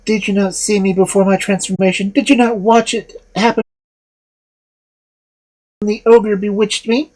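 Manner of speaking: put-on high, reproachful girl's voice; indignant young woman.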